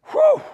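a loud, high burst of relief; delighted whistle-like whew. Acted. Preacher voicing Peter's elation with a loud whew. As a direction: let out a big joyful whew.

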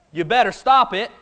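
ordinary talk, nothing marked

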